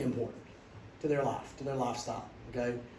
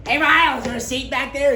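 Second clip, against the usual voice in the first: high, loud, sing-song call of the teammate; loud cheery teammate; 10 semitones higher, 12 dB louder than usual. Retold or imitated. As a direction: shout it up the bus, pitched high and bright. Teammate calls out on bus.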